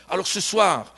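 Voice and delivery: ordinary talk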